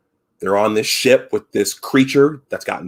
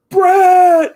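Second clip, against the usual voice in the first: retold yell, much higher and louder; yelled name; 10 dB louder than usual. Crewman calling out to a friend taken away, high yell. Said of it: "Parker yells out".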